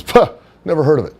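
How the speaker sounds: mock-arrogant gym bro, quick and high; dismissive bro voice. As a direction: brush it off with a quick high snort. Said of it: "that's how he would talk".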